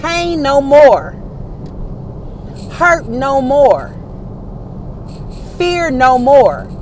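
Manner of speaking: slow, drawn-out declarations with a pause after each; preacher's proclamation. Real, not acted. Preacher evicting pain, fear and hurt, slow proclamation. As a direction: proclaim it firmly, stretching each phrase.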